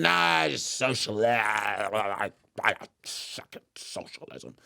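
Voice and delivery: stumbling protest that drops away; mock sputtering. Friend cornered on Marx's theories, mock sputtering. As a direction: imitate someone losing the argument, voice sinking.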